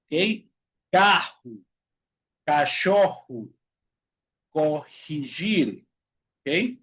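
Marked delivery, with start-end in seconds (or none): 0.9-5.8 "Carro, cachorro, corrigir" with every RR as a throaty jota; double R as J. Shown as a demonstration. Teacher modelling double R, slow J-sound demo. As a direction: space out "cachorro" and "corrigir", RR breathed like Spanish J. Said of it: "tem som também de J em espanhol".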